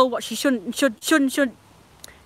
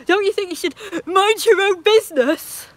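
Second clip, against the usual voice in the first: higher, louder cheeky comeback; pretend retort; 8 semitones higher, 4 dB louder than usual. What she'd say to the busybodies, a higher, louder retort. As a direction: snap back at the busybodies. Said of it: "I would've said".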